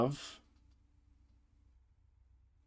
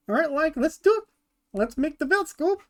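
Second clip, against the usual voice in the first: high-pitched bad Shaggy impersonation; goofy, high mock-Shaggy voice; terrible Shaggy voice; 12 semitones higher than usual. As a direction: pitch it way up, badly imitating the cartoon slacker. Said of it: "That's a terrible Shaggy voice".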